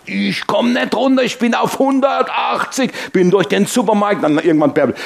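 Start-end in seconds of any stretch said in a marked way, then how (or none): 0.0-2.9 a hint of high fuming thought; fuming inner voice. Preacher recalling his own thoughts at the supermarket, mildly fuming. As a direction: seethe inside, lightly played. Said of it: "Und ich hab so gedacht".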